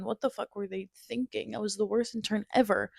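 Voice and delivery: ordinary talk, nothing marked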